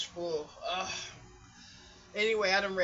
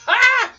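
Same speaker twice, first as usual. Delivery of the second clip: explosive high gasp after a gulp; real outburst after cold water; loud refreshed "Ah!"; 19 dB louder than usual. Real, not acted. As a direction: let out a huge, high "Ah!". Reacting to a drink of water.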